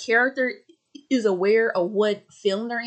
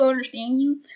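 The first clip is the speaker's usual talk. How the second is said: normal talking voice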